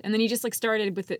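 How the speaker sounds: usual speaking voice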